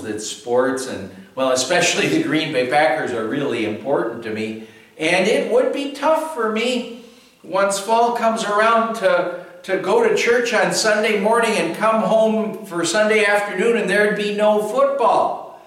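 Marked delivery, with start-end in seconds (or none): 5.0-15.6 preaching. Acted. Sermon builds with force.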